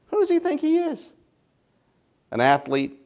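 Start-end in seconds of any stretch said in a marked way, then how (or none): 0.1-1.1 much higher, mocking put-on voice, softer; scoffing disbelief. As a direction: scoff at a politician on TV, high and dismissive. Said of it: "you go".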